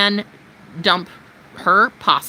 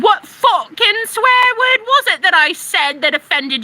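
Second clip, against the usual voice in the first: indignant retort; 8 semitones higher, 3 dB louder than usual. Retold or imitated. Arguing back at a troll.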